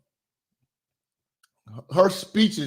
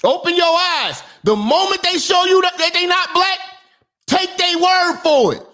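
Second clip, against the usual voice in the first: heated rant; 12 semitones higher than usual. Real, not acted. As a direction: preach it at full volume, fed up. Ranting at viewers about race.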